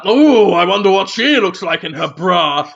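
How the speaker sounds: leering put-on voice, mildly exaggerated; mock ogling aside; a hint of a dirty-minded character; lightly voiced sitcom lecher.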